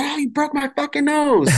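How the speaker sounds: high, squeaky mock complaint; imitating the lady, way up high; mock injured lady. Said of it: "but she said".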